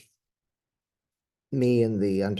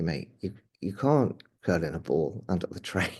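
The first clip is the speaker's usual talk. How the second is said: ordinary talk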